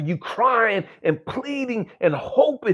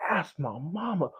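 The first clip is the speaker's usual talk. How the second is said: normal talking voice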